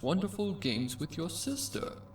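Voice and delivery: dazed cult leader voice; creepy cult leader. Acted. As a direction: coo at her like a dazed madman. Cult voice continues.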